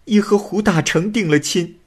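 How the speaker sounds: a forced bride swearing she stays true; tearful young woman. A narrator's reading. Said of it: "女子又说".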